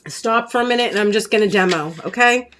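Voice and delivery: ordinary talk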